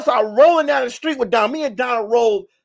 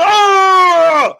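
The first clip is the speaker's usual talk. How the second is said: loud, high, drawn-out "oh"; comic howl from a blow; imitated pain yell; 8 semitones higher, 7 dB louder than usual. Donna hit with a backpack, imitated howl.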